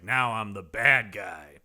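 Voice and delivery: slow, deliberate villain voice. Retold or imitated. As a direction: savour being the bad guy, slowly. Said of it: "But here he's like".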